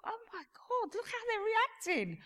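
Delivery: hushed high squeal; thrilled inner thought. Retold or imitated. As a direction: marvel in a soft, high voice. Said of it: "and I thought".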